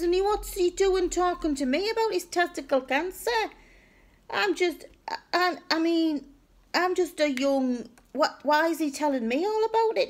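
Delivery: high, flustered retold complaint; mortified protest. Complaining that his uncle discussed cancer with her, mortified. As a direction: protest in a high voice, stumbling over words. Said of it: "I'm mortified".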